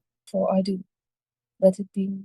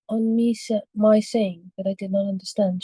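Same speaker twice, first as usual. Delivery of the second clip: plain reading voice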